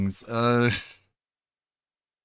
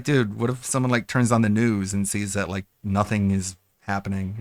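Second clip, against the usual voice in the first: usual speaking voice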